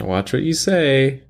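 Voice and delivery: high, slow, warning voice; mock stern warning. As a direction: warn them, slowly and high. Mock warning about his friend.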